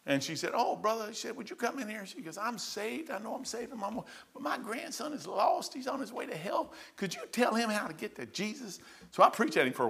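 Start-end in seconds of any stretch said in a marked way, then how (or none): 0.5-2.0 lightly voiced old lady, soft; a hint of a gentle grandma pleading; sweet grandma. Grandma begging the preacher to come in, softly. 2.4-8.8 put-on soft, higher old lady plea; a hint of a worried grandmother; pleading grandma. Grandma asking the preacher to save her grandson, higher and softer.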